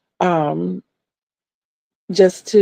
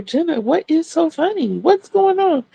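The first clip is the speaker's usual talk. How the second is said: curious; 9 semitones higher than usual. Retold or imitated. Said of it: "I'm asking her".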